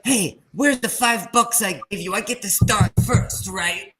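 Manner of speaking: high, loud, sing-song bribe; bribing the referee. Retold or imitated. Imitating the queen asking Israel for the five bucks, high and sing-song. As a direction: sweetly press the referee. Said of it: "She's like".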